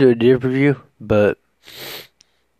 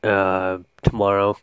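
normal talking voice

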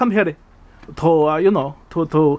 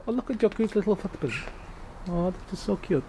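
put-on much softer, tender baby talk; cooing softly; 11 dB quieter than usual. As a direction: coo over the tiny footprint. Cooing over bat's footprint.

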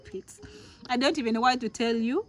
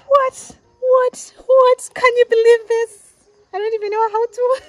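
loud, much higher shrieks of disbelief; ecstatic disbelief; 14 semitones higher, 12 dB louder than usual. Real, not acted. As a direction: scream it out, overjoyed.

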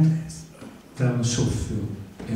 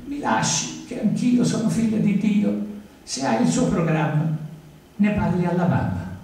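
put-on higher-pitched indignant girl; protesting girl; 7 semitones higher than usual. A narrator's reading. Teresa telling the artist to let go, higher protesting voice. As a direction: plead and scold in a raised pitch. Teresa threatens, pleading to be released.

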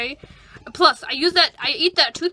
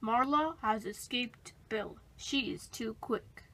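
narration; 3 semitones lower, 11 dB quieter than usual. A narrator's reading. Narrator aside.

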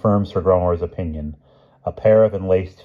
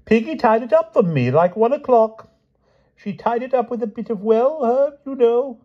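high, gushing then hesitant; excited dotty king; 4 dB louder than usual. A narrator's reading. King describes his bandaged hand, excited and coy. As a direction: trail into coy hinting.

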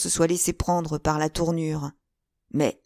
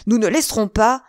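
high, loud protest; indignant outburst, pitched up; exclaimed; 4 semitones higher, 5 dB louder than usual. A narrator's reading. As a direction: object hotly and louder. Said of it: "s'écria Porthos".

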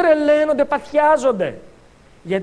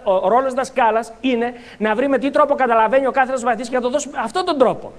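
ordinary delivery

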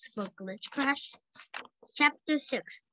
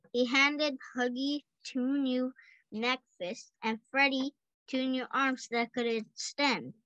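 ordinary delivery, nothing marked

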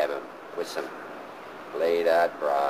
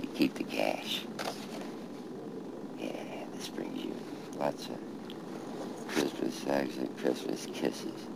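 hushed reading of a card; murmured card reading; quiet reading; 11 dB quieter than usual. Real, not acted. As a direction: read it half under the breath. Reading card at father's grave.

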